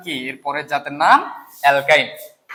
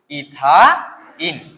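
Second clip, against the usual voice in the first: drawn-out model word; 4 dB louder, much slower than usual. Shown as a demonstration. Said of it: "আমি যেভাবে বলি এইভাবে করো".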